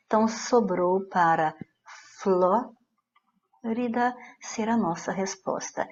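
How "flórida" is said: "Flórida" slowly split after a drawn-out Fló; stress on FLÓ. Shown as a demonstration. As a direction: hold the stressed Fló of "Flórida", pause, then rida. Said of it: "é a antepenúltima sílaba que foi". ("Flórida" com FLÓ alongado e pausa)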